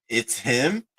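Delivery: rising question on HIM; questioning read-out. Retold or imitated. Reading out his doubting text reply, questioning. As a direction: read "It's him?" with HIM sliding upward.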